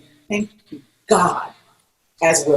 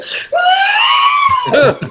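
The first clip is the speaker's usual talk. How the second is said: long, very high reenacted shriek; retold high scream; 3 dB louder than usual. Passenger after hanging up with boss, high shriek. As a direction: let out a high squeal for the story.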